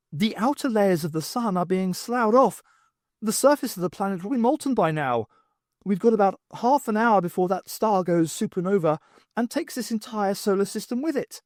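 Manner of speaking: lightly voiced alarmed professor; Professor Owl. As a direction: raise the voice for a worried scholar.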